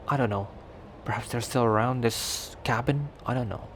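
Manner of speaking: ordinary talk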